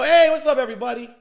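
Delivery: loud, high, eager hello to the crowd; eager stage greeting. Retold or imitated. Young comic greeting the party crowd, loud and eager. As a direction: burst on stage with big energy. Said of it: "I go".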